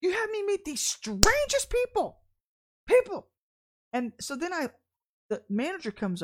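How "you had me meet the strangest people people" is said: high, loud shouting complaint; exasperated shout to God. Retold or imitated. Missy shouting to God in the deli, high and loud. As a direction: cry out to God in frustration. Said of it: "And I'm like".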